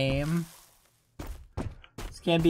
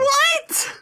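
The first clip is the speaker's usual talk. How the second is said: surprised; 4 dB louder than usual. Real, not acted. Startled reaction.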